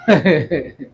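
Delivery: short low chuckle; a brief, low laugh to oneself; real laugh. Real, not acted. Teacher amused by students' mic complaints, low chuckle.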